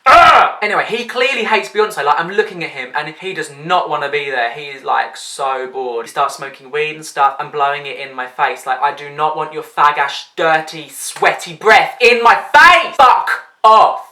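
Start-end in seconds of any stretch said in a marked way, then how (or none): 0.1-0.6 loud, high exasperated ugh; disgusted groan. Real, not acted. Disgusted at pushy boyfriend. 8.8-14.1 imagined rant. Pretend. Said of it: "I didn't actually say that".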